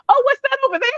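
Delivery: very high, fake-innocent exclamation; mock curiosity to slip away; feigned distraction. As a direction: squeak out an obvious diversion. Acts out dodging the boy.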